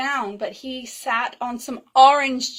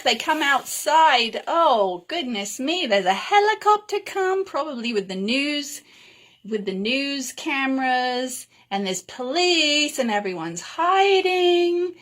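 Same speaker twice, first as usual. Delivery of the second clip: ordinary delivery, nothing marked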